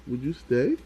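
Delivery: ordinary delivery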